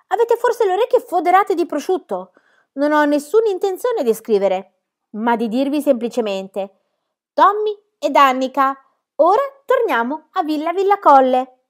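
bowl them over with a mock-indignant cry; loud teasing outburst. A narrator's reading. Pippi teasing Tommy and Annika, loud playful scolding.

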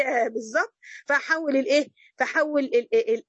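normal talking voice

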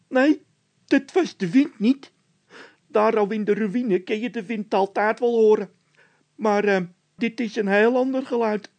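very high, loud scared voice; a nervous squeaky henchman; squeaky frightened De Wit. A narrator's reading. (De Wit, piepend hoog en bang)

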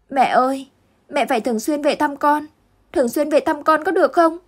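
put-on a boy begging his mother to visit; mild tearful child, pitched high; sobbing child. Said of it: "Việt Anh khóc nấc lên".

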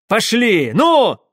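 high, loud urging cry; commanding shout. A narrator's reading. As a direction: drive them off with a sharp order. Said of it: "властно выкрикнул он". (властный выкрик)

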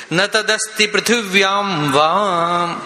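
slow, measured Sanskrit chant; slow devotional verse recitation. Acted. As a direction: intone the shloka slowly, word by word.

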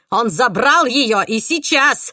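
demanding in a frenzy; frantic shouting hostage taker. Acted. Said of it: "истерично закричал убийца".

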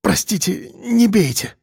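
pleading, frightened babble; babbling in fear. A narrator's reading. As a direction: stammer it out, begging. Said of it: "залепетал Евгений Петрович".

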